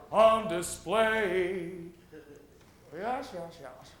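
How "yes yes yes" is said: normal talking voice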